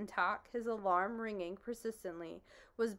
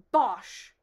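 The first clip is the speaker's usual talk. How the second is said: dismissive bosh; 11 dB louder than usual. Acted. Humbug dismisses the bee.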